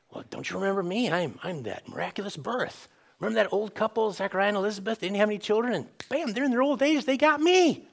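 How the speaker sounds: mock bragging that rises to a high finish; imagined self-promotion. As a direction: play a hammed-up plea to be recognized, climbing in pitch. Acting out what John could have said.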